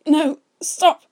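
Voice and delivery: high, very loud plea; panicked protest. A narrator's reading. Man begging his brother to stop, panicked. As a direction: cry out in distress, high and loud.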